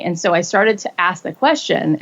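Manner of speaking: ordinary talk, nothing marked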